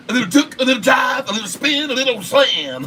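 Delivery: high, shouty hyped-up play call; LeBron James impression. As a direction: sell each move like a hype man. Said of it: "can you do LeBron James impression".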